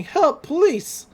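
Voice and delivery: high, drawn-out cry for help; mock scream. Chased pig calls to the police, mock screaming. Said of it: "as Zeus is screaming".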